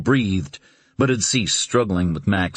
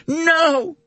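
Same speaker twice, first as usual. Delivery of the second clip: desperate cry. Acted. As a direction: tear it from the throat. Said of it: "The cry burst from her throat".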